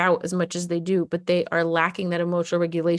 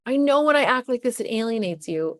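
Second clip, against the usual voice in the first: put-on pleading kid; 7 semitones higher than usual. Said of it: "they're like".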